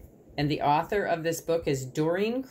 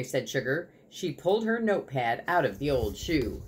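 usual speaking voice

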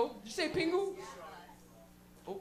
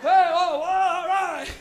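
loud, higher cartoon cries; mock adventure yelling; 5 semitones higher, 12 dB louder, much slower than usual. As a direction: holler through a wild cartoon adventure. Acting out cartoon adventure chaos.

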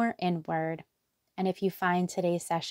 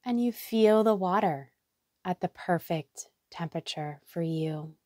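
guided meditation. Acted. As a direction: lead them gently into relaxation, letting each phrase settle. Leads a guided hypnotherapy meditation.

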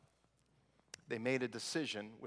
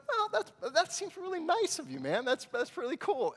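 much higher, admiring; impressed; 4 dB louder than usual. Retold or imitated. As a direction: praise him as a nice guy. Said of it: "I was like".

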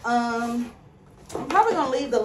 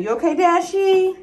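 pet talk; 10 semitones higher, 7 dB louder than usual. Real, not acted. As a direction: coo at a frightened pet. Checks on scared dog Dashy.

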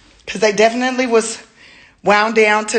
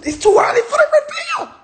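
laughing squeal; 5 dB louder, much faster than usual. Real, not acted. Teasing a reveal.

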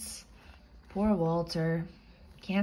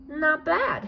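dog voice; 7 semitones higher than usual. Acted. Walter voiced, content.

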